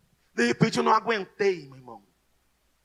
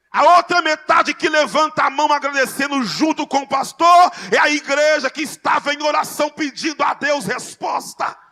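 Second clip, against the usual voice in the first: shouted, much louder and higher sermon climax; full-force preaching; 7 semitones higher, 10 dB louder than usual. Acted. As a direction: preach it at the top of your voice. (pregação inflamada, gritada e bem mais alta)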